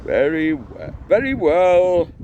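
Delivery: haughty objector. A narrator's reading. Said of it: "said one of the objectors haughtily".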